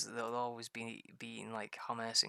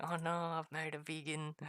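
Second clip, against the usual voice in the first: raised-pitch comic panic; mock freak-out; 5 semitones higher than usual. Husband recalling his worry about his wife, mock panicking. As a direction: replay the dismay, lightly hammed up. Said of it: "Total freaking out and being like".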